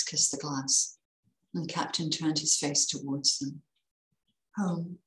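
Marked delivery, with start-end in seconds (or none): 4.6-5.0 soft, understated single word; murmured. A narrator's reading. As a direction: murmur it softly. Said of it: "he murmured".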